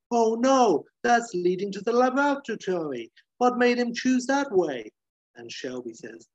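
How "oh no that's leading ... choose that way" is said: high-pitched, hammed-up breathless panic; bad actor soldier. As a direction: overact a winded soldier's dismay, badly. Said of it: "who's a really bad actor, catches up and says, all out of breath".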